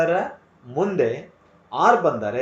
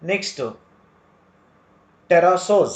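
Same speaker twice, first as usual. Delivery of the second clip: ordinary talk, nothing marked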